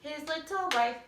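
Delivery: short high mock outburst; quick freak out. Shown as a demonstration. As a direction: snap it out fast and funny. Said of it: "It's just really fast".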